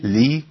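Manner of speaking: لي with a clear i; lam with kasra demo. Shown as a demonstration. Sheikh modelling لي for students. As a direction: say لي lightly. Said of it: "اللام المكسورة".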